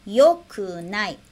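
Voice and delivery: "yokunai" drawn out slowly, syllable by syllable; slow teaching demo. Shown as a demonstration. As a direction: stretch out "yokunai" so students can copy it.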